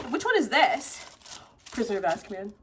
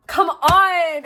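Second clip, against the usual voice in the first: loud, raised-pitch exclamation; loud coaxing; 5 semitones higher, 7 dB louder than usual. Real, not acted. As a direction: push it out louder and higher. Second plea, escalated.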